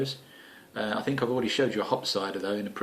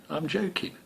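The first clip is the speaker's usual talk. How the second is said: a sly whispered aside, softer; 8 semitones higher, 5 dB quieter than usual. Acted. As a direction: whisper it like a secret. Whispered after the rude joke.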